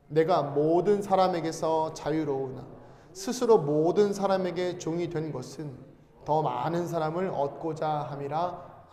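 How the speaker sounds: slow, measured recitation of scripture; scripture reading. Acted. Pastor leading the congregation in a slow scripture reading. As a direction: read it aloud slowly, like reciting the Bible together.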